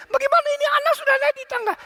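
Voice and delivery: very high, fast mother-in-law shriek; imitated panicked yelling. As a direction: screech it in a mock panic. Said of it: "terus dia teriak".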